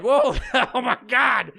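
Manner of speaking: high, laughing imitation of astonishment; amazed son. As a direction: imitate a son's amused shock. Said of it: "my son saw it and he was like".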